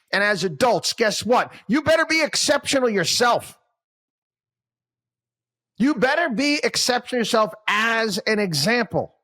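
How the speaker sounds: revved-up motivational coaching; fired-up coach pep talk. Acted. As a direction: preach it at the team like a coach at halftime.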